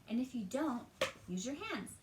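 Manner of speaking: plain reading voice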